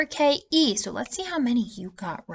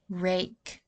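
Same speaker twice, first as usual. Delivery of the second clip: usual speaking voice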